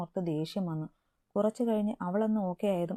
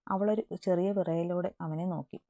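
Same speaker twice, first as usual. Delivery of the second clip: ordinary delivery, nothing marked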